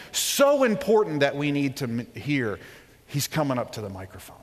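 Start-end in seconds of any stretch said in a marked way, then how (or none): none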